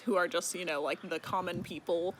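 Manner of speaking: ordinary delivery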